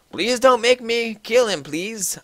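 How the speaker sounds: begging. A narrator's reading. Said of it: "I begged".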